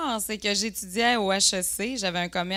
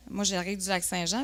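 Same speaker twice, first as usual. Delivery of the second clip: normal talking voice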